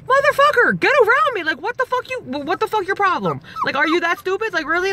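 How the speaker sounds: high, indignant retold yelling; mock screaming. Stranded driver yelling at honking cars, high mock scream. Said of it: "so I'm screaming".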